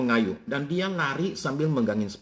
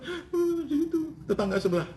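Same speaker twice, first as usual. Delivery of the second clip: put-on much higher childlike voice; a small boy's high voice; crying little boy. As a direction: play the small son telling on the neighbour. Child voice telling his mother.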